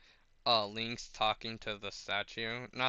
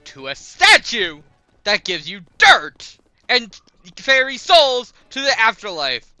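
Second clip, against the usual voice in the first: much higher and louder, disbelieving; incredulous Purah; 10 semitones higher, 17 dB louder than usual. Retold or imitated. Purah spelling out Link's statue talk, loud high disbelief. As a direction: spell it out in shock. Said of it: "She's like".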